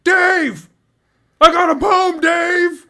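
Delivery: high, full-voiced holler imitating a drunk concertgoer; loud, high-pitched imitated heckling; drunken fan yelling. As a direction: yell up at the stage for attention. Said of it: "he starts yelling".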